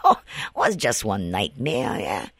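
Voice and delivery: ordinary delivery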